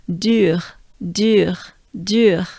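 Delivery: "Dur" with droopy U gliding into breathed French R. Shown as a demonstration. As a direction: exhale the final R of "dur". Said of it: "droopy face goes into a R".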